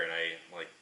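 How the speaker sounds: ordinary delivery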